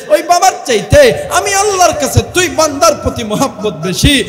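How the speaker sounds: loud, high impassioned sermon cry; preaching at full force. Acted. Preacher pleading loudly with the sinning youth. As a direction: thunder it out to the crowd.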